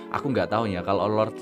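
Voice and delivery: normal talking voice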